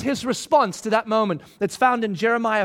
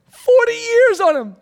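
"forty" flung out much higher and louder with a cry; exasperated cry; 10 dB louder than usual. Shown as a demonstration. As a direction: wail "forty years on 'em" like a fed-up father. Repeats with a cry.

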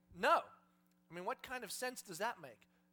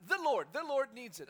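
loud, hurried repeating of the password; flustered blurting; 3 semitones higher, 10 dB louder than usual. Acted. Caught disciple producing the code word, flustered and loud.

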